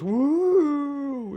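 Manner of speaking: high, loud whooping sitcom crowd; a canned audience cheering the entrance. Retold or imitated. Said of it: "And the crowd goes".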